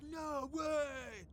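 drawn-out cry of disbelief; excited retold disbelief; mock amazement. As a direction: imitate a stunned crowd, stretching it out. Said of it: "people were like".

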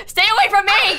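laughing shout of alarm. Real, not acted. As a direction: yell it, half laughing. Player fleeing suspected imposter.

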